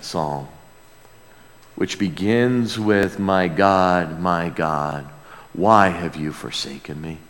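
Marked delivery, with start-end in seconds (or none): none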